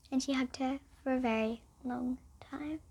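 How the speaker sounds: tender hushed whisper; soothing bedtime whisper. Acted. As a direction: soften to a whisper at the end.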